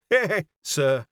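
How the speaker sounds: mildly exclaiming Yorkshire landlady; lightly voiced country exclamation. A narrator's reading. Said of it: "she cried".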